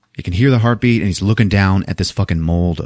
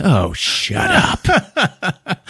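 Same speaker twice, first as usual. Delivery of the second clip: laughing through a slow oh shut up; drawn-out laughing brush-off; laughing quote; 10 semitones higher, much slower than usual. Retold or imitated. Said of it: "Stone just goes".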